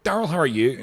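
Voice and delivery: high, loud Scottish sliding into Northern Irish; attempted Scottish accent. Shown as a demonstration. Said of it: "drift into this Northern Irish accent".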